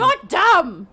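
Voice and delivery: high, loud goblin taunt. Acted. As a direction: shout it like a bratty goblin. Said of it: "One of the goblins says".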